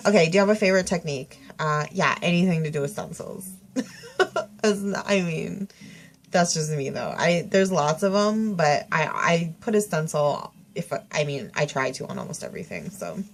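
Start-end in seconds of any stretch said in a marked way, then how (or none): none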